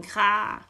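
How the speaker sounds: loud imitated crow croak; raven's caw. A narrator's reading. Raven insisting he simply knows, loud caw. Raven character caws mid-speech.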